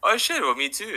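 a mock startled "me too"; surprised. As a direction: caught off guard, pleased. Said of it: "I'm like".

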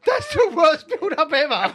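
high, slow, laughing complaint. Real, not acted. Co-host repeating the heckle at the stalled impression, laughing. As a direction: wail it through the giggles.

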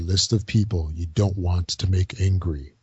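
low, slow trailer announcer; deep dramatic voiceover for a film; movie trailer voice. Acted. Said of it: "in my movie voice".

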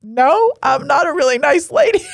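high, laughing self-mocking reply; teasing jokey no; playful denial. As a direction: toss it off with a laugh. Jokingly correcting the stranger.

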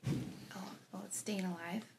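whisper. Pretend. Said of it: "the owl's like".

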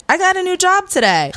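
very high, loud retold announcement; high blurted announcement. Guest re-enacting breaking job news to mama, high blurt. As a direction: blurt it out in a squeaky-high, giddy pitch. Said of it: "This is the same way it came out".

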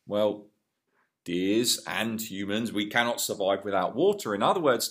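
usual speaking voice

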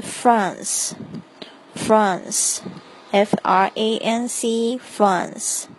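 France said slowly, then spelled F R A N C; slow spelling demo. Shown as a demonstration. Teacher spelling France for learners, slowly. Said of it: "法国是France".

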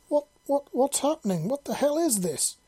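very high, stammering shock; high-pitched acted disbelief; startled exclamation. A narrator's reading. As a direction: squeak out bewildered alarm. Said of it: "she exclaimed".

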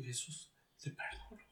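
very soft, low, fading into a whisper; reenacted whispered forgiveness; retold hushed confession. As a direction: barely get the words out. Retells his reluctant whispered forgiveness.

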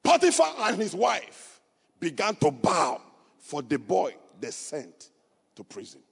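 loud, high preaching cry; full-force preaching. Acted. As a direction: preach it to the rafters. Preaching climax about Joseph.

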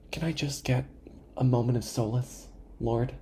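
very soft, mock plea; hushed, put-upon appeal; weary prayer. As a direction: beg heaven quietly after a sigh. Weary plea addressed to Lord.

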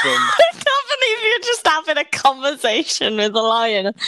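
loud laughing outburst through the words; laughing disbelief. Real, not acted. Cohost laughing loudly at the lion conversation idea. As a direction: burst out laughing while saying it.

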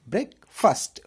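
ब्रेकफास्ट with fust, brek-fust; ब्रेकफास्ट, second part fust not fast; correct breakfast. Shown as a demonstration. Teacher models ब्रेकफास्ट for students. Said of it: "real pronunciation होगा".

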